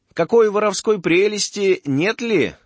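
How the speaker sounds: high, wary merchant voice; suspicious merchant. Acted. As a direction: sniff out the trick. Merchant suspects a trick.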